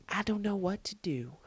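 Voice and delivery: hushed, whispered bewilderment; helpless whisper. Real, not acted. Storyteller to listeners about the awkward situation, baffled whisper. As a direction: drop to a whisper, at a loss.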